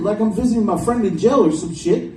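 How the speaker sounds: usual speaking voice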